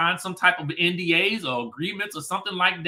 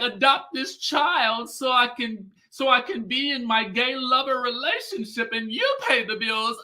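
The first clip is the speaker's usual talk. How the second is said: lightly voiced scheming wife; mock Courtney; 7 semitones higher than usual. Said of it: "she was like".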